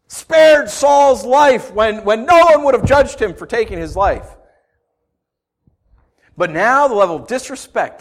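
loud, rising, urgent preaching; preacher shouting David's fury; preaching at full force. Real, not acted. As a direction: hammer home his rage, building.

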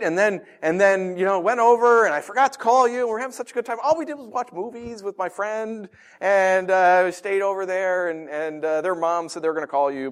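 lightly voiced sheepish daughter; pleading teen. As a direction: talk fast to explain away. Daughter explains herself.

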